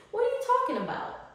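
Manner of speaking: high and quick, disbelieving; incredulous. Retold or imitated. Speaker scolding her own self-doubt, high and fast. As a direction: snap back at your own doubt.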